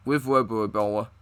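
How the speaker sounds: ordinary talk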